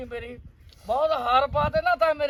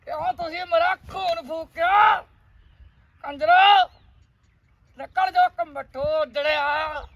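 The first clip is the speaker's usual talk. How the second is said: higher, loud furious ranting, slowing near the end; angry rant; 4 semitones higher, 7 dB louder than usual. Acted. Scene: father ranting at his lovesick son, loud and furious. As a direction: rage at the son for ruining him.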